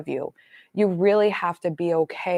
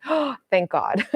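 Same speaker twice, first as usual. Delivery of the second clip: slow, higher sigh of retold relief; relieved; 4 semitones higher, slower than usual. Woman hearing her keys can be retrieved, hugely relieved. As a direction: gasp it out slowly, as if let off the hook.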